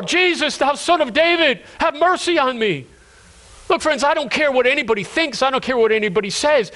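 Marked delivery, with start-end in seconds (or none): 0.0-2.9 high, insistent cry for mercy; impassioned plea of a blind man; crying out more. Acted. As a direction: call out over a crowd, pitch well up. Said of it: "the man cries all the more".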